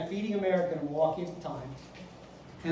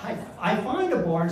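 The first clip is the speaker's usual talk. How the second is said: ordinary delivery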